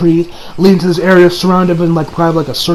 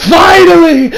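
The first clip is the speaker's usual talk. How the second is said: loud, high gleeful yell of victory; bursting out in mad triumph; triumphant villain shout; 7 semitones higher, 4 dB louder, much slower than usual. Retold or imitated. Villain gloats over the stolen soul, shouting with glee.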